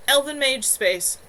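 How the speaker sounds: slow, loud comeback; a pointed retort, slow and loud. A narrator's reading. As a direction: fire it back at him. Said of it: "Kevin retorted".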